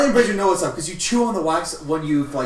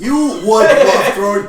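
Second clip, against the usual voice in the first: laughing, slow and loud; laughing shout; 4 semitones higher, 8 dB louder, slower than usual. Real, not acted. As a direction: tease through laughter.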